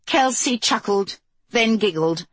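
usual speaking voice

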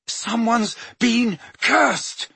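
high, slow bellow over wind. Acted. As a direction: stretch it out over a roaring gale. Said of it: "bellowed Harry".

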